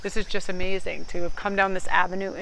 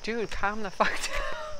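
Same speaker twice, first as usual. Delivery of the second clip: laughing calm-down; 3 semitones higher, 3 dB quieter, slower than usual. Retold or imitated. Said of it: "I'm like".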